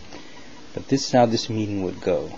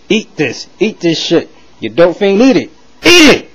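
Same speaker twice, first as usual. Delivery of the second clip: shouting higher and louder, building to a scream; furious yelling; 8 semitones higher, 10 dB louder than usual. Acted. Obama shoving food at the lookalike, furious building shout. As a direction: bellow it as you shove food down his throat.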